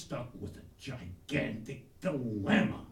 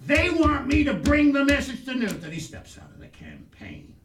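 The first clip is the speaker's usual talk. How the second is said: loud, high blurted explanation; hammed-up frustrated shout; exasperated outburst; 7 semitones higher, 9 dB louder than usual. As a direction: burst out with it. Exasperated reply to father.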